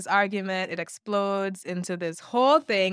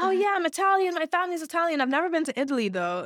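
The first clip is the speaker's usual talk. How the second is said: much higher, mocking valley voice; mocking Italian-American; 8 semitones higher, faster than usual. Mocking Americans who claim to be Italian, high silly voice. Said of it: "Americans say that shit".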